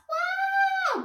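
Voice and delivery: put-on long piercing child's wail; bawling cry, very high and loud. A narrator's reading. Weepy pupil cries in class, wailing. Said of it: "wailed Weepy William".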